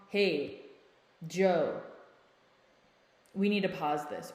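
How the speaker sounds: firm, level teacher voice that means business; slow it down and state it matter-of-fact. Shown as a demonstration. Calm parent halting a partner who is yelling, in a firm teacher voice. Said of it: "it's like teacher voice".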